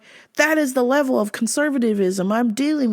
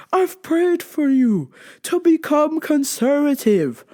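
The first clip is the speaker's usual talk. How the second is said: higher, slow, drawn-out mock elation; overjoyed praying dad; 6 semitones higher than usual. Dad hearing his child likes Jordan Peterson, hammed-up overjoyed relief. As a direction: play up the dad's fervent relief. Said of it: "immediately my dad goes".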